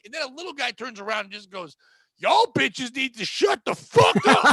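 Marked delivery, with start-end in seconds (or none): 2.2-4.5 loud, drawn-out mock bellow; retold shouting. Little guy turning round to the arguing women, imitated shouting. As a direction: bellow it slowly, imitating a fed-up passenger. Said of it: "they're fighting and screaming".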